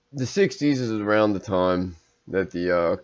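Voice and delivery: ordinary talk, nothing marked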